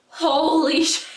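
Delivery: loud, high laugh mid-exclamation. Retold or imitated. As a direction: burst out laughing in disbelief. Reacting to armada arrival.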